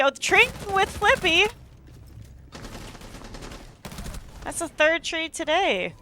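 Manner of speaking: sudden high excited call. Real, not acted. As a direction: jump up in pitch, thrilled. Caster reacts to a trade.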